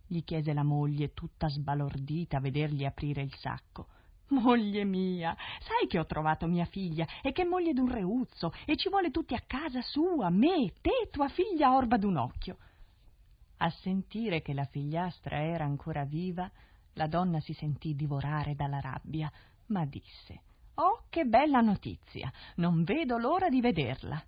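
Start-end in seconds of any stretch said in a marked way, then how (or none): 4.3-12.6 husband bursting to tell his wife; excited father. Acted. Father telling his wife the news, high and excited. 20.7-24.2 high, loud false cheer hiding rage; feigned delight. Acted. Stepmother to husband, faking joy at the news.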